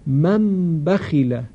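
من بخیله slowly, the n of من turned into a nasal m gliding into ب; slow careful tajweed reading of من بخیله, n becomes m; iqlab demo. Shown as a demonstration. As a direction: read من بخیله slowly as "mam bakhila". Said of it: "بخوانیم".

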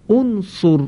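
انصر said as unsur, opening with a short u; Arabic model word. Shown as a demonstration. Teacher shows students how to say the verb, high and deliberate. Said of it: "به این فعل امر نگاه کنید".

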